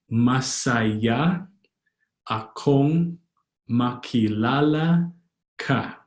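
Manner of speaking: long syllables and gaps between words; slow, word by word. Shown as a demonstration. Teacher teaching "happy to meet you", slowly word by word. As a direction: stretch each word of "Masaya akong makilala ka". Slow model, then joined repeat.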